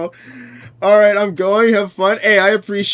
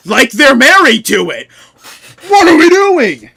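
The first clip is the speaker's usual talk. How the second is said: much higher, louder cry of disbelief; exasperated outburst; 6 semitones higher, 9 dB louder than usual. Real, not acted. Streamer exasperated at New Yorkers, much higher loud outburst. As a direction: throw your hands up loudly.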